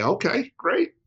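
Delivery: chirpy mom. Retold or imitated. Mother brushing off her sick son, breezy and cheery. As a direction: sing it out brightly like an unbothered mother.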